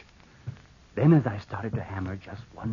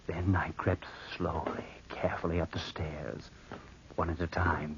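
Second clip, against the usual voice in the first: creeping, breathless whisper; whispered narration; 3 semitones lower, 7 dB quieter than usual. A narrator's reading. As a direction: whisper as if sneaking past.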